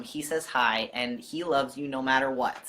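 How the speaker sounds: ordinary talk, nothing marked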